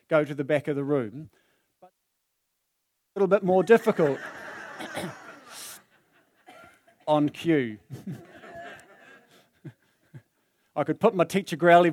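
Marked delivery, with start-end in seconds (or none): 1.8-9.7 laughing. Real, not acted. Laughs at failing to cough.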